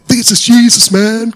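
loud, high, slow triumphant cry; joyful exclamation. Retold or imitated. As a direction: burst out in amazed joy. Second salesman exclaims the same.

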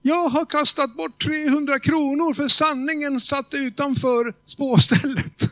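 a retold cry of regret, drawn out. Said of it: "Och så ropar hon".

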